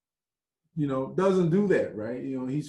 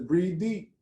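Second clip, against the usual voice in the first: a hint of the guiding woman; 7 semitones higher, 3 dB louder than usual.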